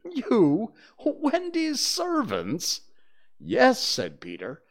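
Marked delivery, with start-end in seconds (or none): 0.0-2.8 very high, slow incredulous questions; appalled squeak of a question; astounded. Acted. John appalled at being servants. 3.4-3.8 high, loud, firm yes; emphatic, no-argument reply; commanding. Acted. Peter commands the stunned brothers.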